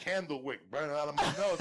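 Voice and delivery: normal talking voice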